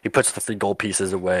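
ordinary talk

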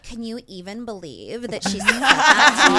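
put-on gushing Valley girl gossip. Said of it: "you haven't even heard me do my Valley Girls".

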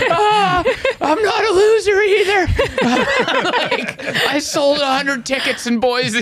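high, laughing fake cry; mock sobbing. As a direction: wail through laughter like a broken comic. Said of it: "I'm just sobbing on a six A M flight".

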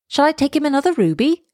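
lightly voiced eager question; swallow's question. A narrator's reading. Swallow asks the prince eagerly.

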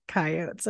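"coyotes" in two syllables, dropped low and soft; regional pronunciation. Retold or imitated. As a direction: say "coyotes" as KAI-ohts, no final ee. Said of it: "you called them coyotes".

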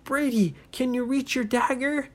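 high-pitched urgent question; urgent, straining call. Acted. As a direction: call up to a trapped friend. Friends straining call to trapped boy.

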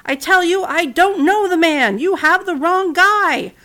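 loud, high, slow desperate yell; yelled denial. Acted. Peter yelling at the servant, loud high desperate denial. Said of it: "He raised his fists and yelled".

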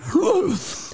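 sharp, high, shocked gasp; mock gasp. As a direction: gasp in fake outrage. Mocking lefty reaction.

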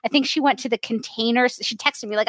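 ordinary delivery

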